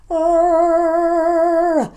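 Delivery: loud high growled "Arr"; closed R sound. Shown as a demonstration. Showing how the tongue makes that R, loud and high. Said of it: "to create that noise".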